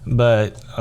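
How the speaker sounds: normal talking voice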